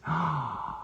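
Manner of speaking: sharp high intake of breath; delighted gasp. Real, not acted. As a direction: gasp in happy surprise. Spots a friend in chat.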